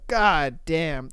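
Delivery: normal talking voice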